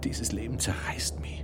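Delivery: breathless whisper, no voice; whispered despair. Acted. Louis whispers his anguish to the Mother of God. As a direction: whisper it like a confession.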